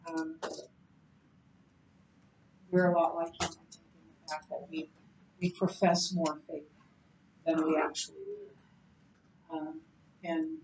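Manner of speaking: ordinary delivery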